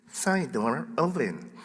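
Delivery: slow, soft automated announcement; be the alarm system's voice, slowly and softly; Telus alarm lady. Retold or imitated. Pastor imitating the office alarm lady, slow robotic announcement. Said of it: "I'd hear this".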